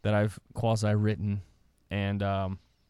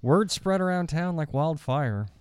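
lightly gruff storybook read; audiobook narration. A narrator's reading. Reading a made-up story aloud as the narrator, mock serious. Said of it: "I'm gonna read it in a gruff, gruff voice".

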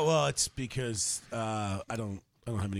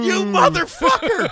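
high, loud, outraged yell; hammed-up furious scream; mock outraged shout; 12 semitones higher, 9 dB louder, slower than usual. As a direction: scream it like the wronged fiancée. Voicing the fiancée's reaction.

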